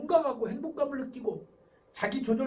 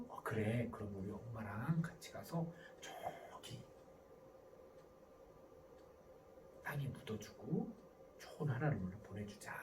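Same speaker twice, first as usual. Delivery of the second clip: low, soft, slow comforting parent; warm empathy; 12 semitones lower, 14 dB quieter than usual. Acted. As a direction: tenderly agree to the child's request. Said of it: "마음주기 스타일이 있어". (낮고 느리게 다정히 달래며)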